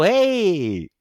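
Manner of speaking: usual speaking voice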